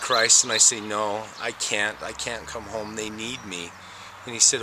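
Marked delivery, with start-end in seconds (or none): 0.8-3.7 quiet plea. Retold or imitated. Refusing Christ, wanting to stay.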